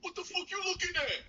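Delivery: very high, strained mock yell; imitated New York aggressor. New Yorker confronting someone over a look, mock high-pitched outrage. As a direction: squawk a hammed-up street challenge. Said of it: "they'll be like".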